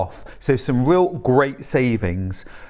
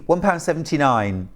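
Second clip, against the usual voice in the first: normal talking voice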